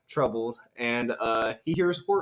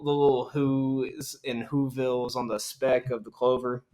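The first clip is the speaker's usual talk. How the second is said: ordinary talk, nothing marked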